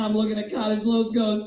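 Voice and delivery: ordinary talk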